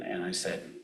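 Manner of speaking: normal talking voice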